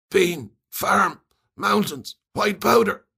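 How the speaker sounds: high, tumbling rush of words; sedated guard blurting his clues. A narrator's reading. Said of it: "It all came out in a rush".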